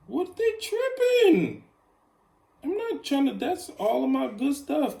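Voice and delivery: very high-pitched, loud indignant outburst; high, loud complaining at the phone; getting real. Real, not acted. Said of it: "See, I had to get real right there".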